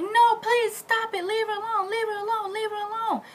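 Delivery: very high, stretched mock wail; acted desperate plea. Pleading for the shot cousin, high acted wail. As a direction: beg them off in a high-pitched cry.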